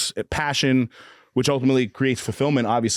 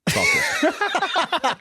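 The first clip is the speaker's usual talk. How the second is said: mocking squeak. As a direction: squeak the word like a taunt. Answers own question, listener laughs.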